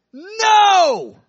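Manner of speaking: a high, loud shouted NO. Retold or imitated. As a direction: yell the refusal out hard. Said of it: "real loud".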